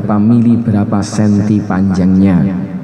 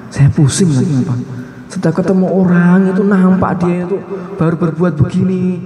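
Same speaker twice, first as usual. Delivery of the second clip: high, rushing voice of a man overwhelmed by visions; higher, harried complaint from someone who sees too much; flustered seer; 7 semitones higher than usual. Retold or imitated. Preacher voicing a guest troubled by his visions, higher and flustered.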